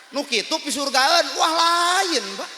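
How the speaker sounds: loud, high shout of dismissal; exclaimed rebuke. Real, not acted. Preacher telling the crowd that is no heaven-bound person, shouted rebuke.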